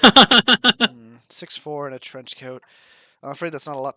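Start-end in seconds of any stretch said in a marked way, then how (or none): 0.0-1.2 thoughtful hum. Acted. NPC humming thoughtfully.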